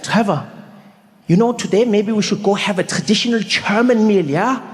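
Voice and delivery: put-on eager host with a German accent. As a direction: imitate a German pal, rising at the ends. Acts his German friend Rolf.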